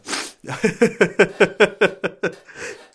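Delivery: long, high, loud laugh; real staccato belly laugh. Real, not acted. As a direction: burst into a rolling laugh. Laughs at his retold reply.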